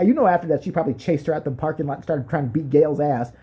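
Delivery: ordinary talk, nothing marked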